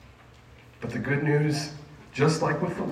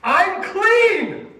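high, loud cry of the healed; ringing, elated high yell; joyful shout; 7 dB louder than usual. Retold or imitated. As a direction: shout the good news out to everyone. Healed leper shouts his news.

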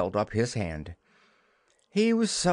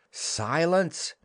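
sharp call; 6 semitones higher than usual. Acted. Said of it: "cried Wendy".

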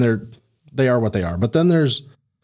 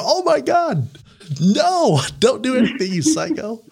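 high-pitched, hammed-up horror; mock panic; 12 semitones higher, slower than usual. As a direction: plead in exaggerated alarm at a maniac. Acting horrified reply to chainsaw intruder.

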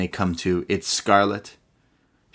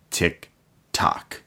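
ominous villain; 7 semitones lower, 5 dB quieter than usual. Retold or imitated. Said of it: "He says".

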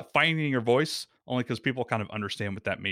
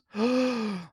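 sharp high panicked gasp; startled gasp; 11 semitones higher than usual. Shown as a demonstration. Host reenacting his first recording, panicked gasp. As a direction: gasp as the record light goes on. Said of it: "I'm gonna do a reenactment right here".

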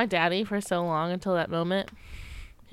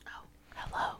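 soft breathy hello; whisper; 16 dB quieter than usual. Pretend. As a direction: whisper a greeting into the mic. Joins ASMR whisper joke.

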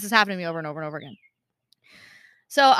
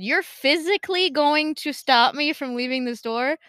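raised, slow, incredulous challenge; got loud; 6 semitones higher, 6 dB louder, slower than usual. Retold or imitated. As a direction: retell a loud protest, higher and drawn out. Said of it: "she said she got loud".

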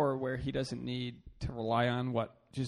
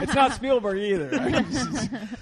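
real laugh through slow, raised words; laughing denial; 7 semitones higher, 5 dB louder, much slower than usual. Real, not acted. Joking about who got bleeped, laughing. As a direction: say it loud and high, cracking up.

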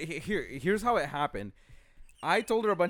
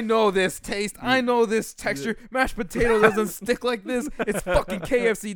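louder, higher, emphatic declaring; mock outraged accusation; 6 semitones higher, 5 dB louder than usual. Imitating Jessica exposing the KFC recipe, mock outrage. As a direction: build the case loudly, point by point.

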